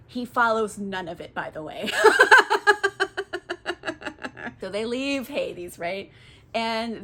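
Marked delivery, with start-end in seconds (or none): 1.9-4.5 laugh. Real, not acted. Laughs at own joke.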